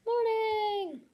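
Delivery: high, loud drawn-out call; big wake-up cry. Real, not acted. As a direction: holler it in a long, high note. Calls out as game morning comes.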